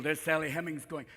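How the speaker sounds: usual speaking voice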